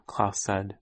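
ordinary delivery

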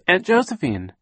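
high, loud searching call, falling at the end. A narrator's reading. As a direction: call out for a missing aunt. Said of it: "she called".

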